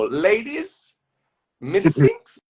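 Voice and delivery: high, theatrical, self-important announcement; hammed-up pompous actor; pompous self-involved guy. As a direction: address the room with lofty pomposity. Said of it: "I was playing a pompous, self-involved guy".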